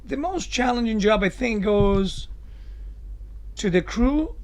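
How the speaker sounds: ordinary talk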